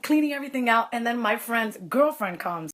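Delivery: ordinary talk, nothing marked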